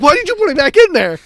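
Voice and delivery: high, loud disbelieving yell; incredulous scolding. Retold or imitated. Acted scolding of Eli.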